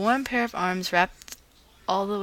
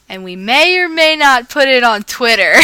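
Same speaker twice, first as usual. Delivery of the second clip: loud, high playful tease; teasing; 4 semitones higher, 12 dB louder than usual. Acted. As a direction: rub it in with glee. Friends teasing the couple.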